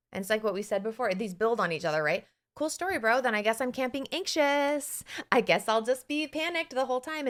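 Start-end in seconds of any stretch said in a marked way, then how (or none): 2.6-7.3 breezy dismissal. Retold or imitated. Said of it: "dismissing anxious brain".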